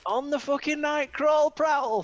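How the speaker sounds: high, loud pirate radio DJ hype; DJ Nightcrawler hype. Acted. MC hyping the radio crowd in a loud high DJ voice. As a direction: bellow it like an MC on air. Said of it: "D J Nightcrawler on the fucking line".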